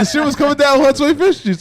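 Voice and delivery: buzzing with excitement over the truck; hyped. Real, not acted. Hyped guest telling the radio hosts about the truck. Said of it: "He was hyped".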